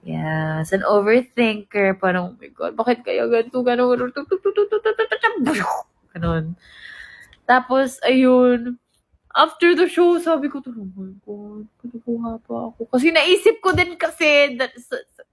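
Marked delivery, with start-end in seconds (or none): 2.3-5.7 spiralling overthinking. Retold or imitated. Overthinker imitating own racing mind, spiralling. 10.6-12.9 quiet dismay. Retold or imitated. Quotes self after show.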